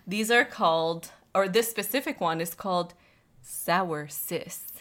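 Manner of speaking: slow mock ad read; slow, drawn-out commercial voice; sponsor read.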